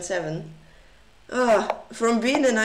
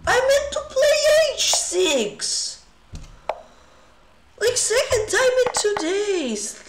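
high, loud scream of frustration; real screaming; 12 semitones higher, 4 dB louder, slower than usual. Real, not acted. Streamer protesting a misplayed chess move to viewers, screaming. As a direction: scream it out, pitched way up. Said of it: "for screaming".

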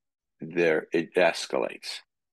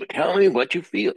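louder, higher pushing demand; frustrated demand; 11 semitones higher, 5 dB louder than usual. Retold or imitated. Frustrated partner demanding feelings, raised and insistent. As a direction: push hard for an answer.

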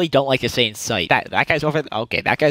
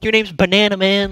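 higher-pitched imitation of her making fun; mock teasing; 7 semitones higher, 3 dB louder than usual. Girl making fun of his alpha male claim, mock teasing. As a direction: tease lightly in her imitated voice. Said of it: "started making fun of me".

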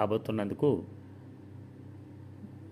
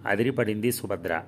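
plain reading voice

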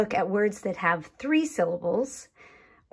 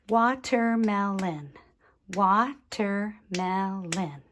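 "Watermelon" in four beats, slowing on the repeat; clapped syllables. Shown as a demonstration. Teacher clapping with children, "Watermelon" split into syllables. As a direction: clap out "Watermelon" as wa, ter, mel, on. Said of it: "Let's clap it".

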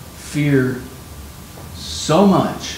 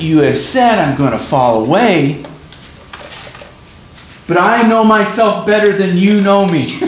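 indignant; 5 semitones higher, 5 dB louder than usual. Retold or imitated. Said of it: "He's like".

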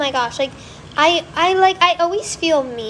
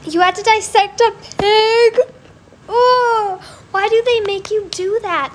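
higher, louder, outraged disbelief; shocked; 8 semitones higher, 5 dB louder than usual. Real, not acted. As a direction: gasp it out in horror. Shocked by pig dissection.

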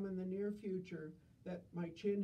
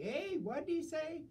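put-on deaf old lady; 8 semitones higher than usual. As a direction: shout the question, pitched way up.

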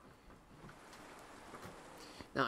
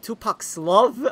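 laughing; 8 semitones higher, 13 dB louder than usual. Real, not acted. Bursting into laughter mid-sentence.

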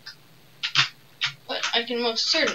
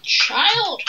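high loud call; 10 semitones higher, 6 dB louder than usual. Pretend. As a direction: call across the field to the child. Calling out to game child.

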